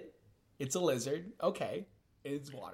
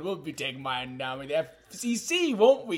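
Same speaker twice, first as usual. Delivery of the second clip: higher, louder hammed-up Cockney; mock British accent; 3 semitones higher, 6 dB louder than usual. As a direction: squeal it like a cartoon Londoner. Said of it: "I see why we're talking like this now".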